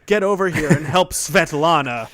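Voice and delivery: loud, hammed-up American game dub; mock American voice actor. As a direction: call out in a broad American accent. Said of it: "they cast American voice actors".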